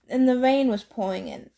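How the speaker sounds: usual speaking voice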